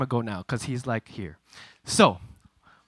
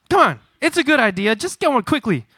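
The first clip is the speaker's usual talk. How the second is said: loud, high insistence; acted-out pressing plea, voice raised; urgent coaxing; 9 semitones higher, 6 dB louder than usual. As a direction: push him to do it right away. Acts urging brother to hit back.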